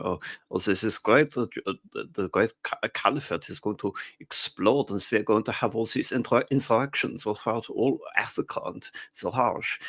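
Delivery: put-on German accent, halting and exclaiming; mock German accent. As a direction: play an excited German general with a comic accent. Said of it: "that was a great accent".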